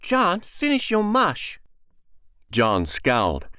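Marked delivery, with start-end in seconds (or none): 0.0-1.6 put-on higher, slow little girl scolding; a small sister bossing her brother. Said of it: "stopped suddenly and frowned".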